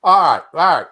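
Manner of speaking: rally everyone to start; loud. Real, not acted. Host opens the show loudly.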